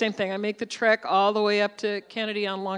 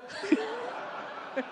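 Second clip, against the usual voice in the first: high laugh; 12 semitones higher, 7 dB quieter than usual. Real, not acted. Laughs at the story.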